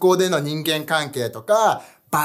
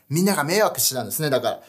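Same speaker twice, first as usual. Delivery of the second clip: ordinary talk, nothing marked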